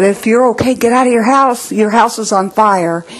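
mock shout of warning, not full force; retold calling out. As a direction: imitate calling into a burning house. Calling to woman in burning house.

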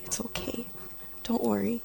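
very soft, hushed reassurance; tender whisper. Retold or imitated. Jesus holding her tight, whispering comfort. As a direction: barely breathe the comfort.

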